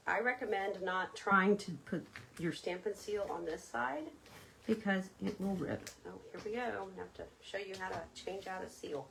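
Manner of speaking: ordinary talk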